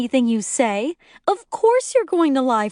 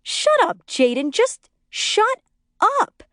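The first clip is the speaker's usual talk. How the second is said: furious snap; 13 semitones higher, slower than usual. A narrator's reading. Wife yelling at narrator to shut up. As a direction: cut her off sharply.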